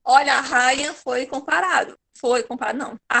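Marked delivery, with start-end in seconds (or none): none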